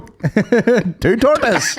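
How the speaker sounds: very slow, stretched mock order, laughing. Friend repeating the joke order, very slow and laughing. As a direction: drag each word out, grinning.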